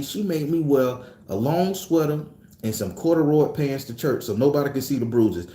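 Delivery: ordinary talk, nothing marked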